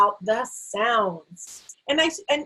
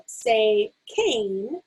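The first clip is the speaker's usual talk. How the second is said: drill prompt. Shown as a demonstration. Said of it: "when I to do drills like I say".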